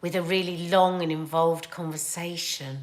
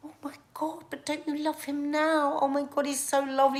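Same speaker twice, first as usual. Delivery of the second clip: higher, breathless-sounding coaxing; retold gushing plea; 7 semitones higher than usual. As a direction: plead his case to a sister, acted out. Acting out pleading with her sister.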